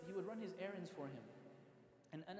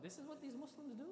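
higher-pitched incredulous question; retold disbelief; 8 semitones higher than usual. Man reacting to these Muslims, incredulous. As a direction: imitate someone taken aback.

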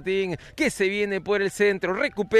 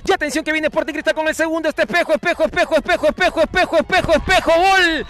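fast, rising to a higher, louder goal yell; building goal call; 5 semitones higher, 4 dB louder, faster than usual. Real, not acted. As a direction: chant the name faster and faster into the goal. Goal chance called, building.

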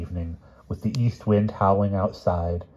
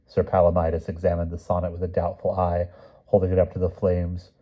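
ordinary delivery, nothing marked

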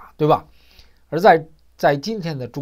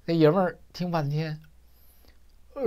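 usual speaking voice

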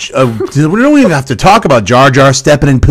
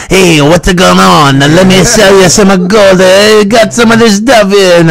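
gold seller voice; 7 semitones higher, 4 dB louder than usual. Retold or imitated. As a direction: play the shady gold dealer, pitched up. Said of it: "the guy who's got, selling the gold".

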